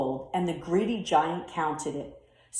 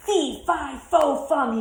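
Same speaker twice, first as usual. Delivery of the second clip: giant crying out; 6 semitones higher, 4 dB louder than usual. A narrator's reading. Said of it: "he cried".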